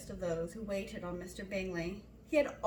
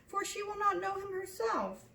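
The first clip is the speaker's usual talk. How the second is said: much higher, nearly tearful mother; distressed mother; 10 semitones higher, 4 dB louder than usual. A narrator's reading. Mother lamenting Mrs Long's absence, tearful and higher. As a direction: wail it, all is lost. Mother fretting, near tears.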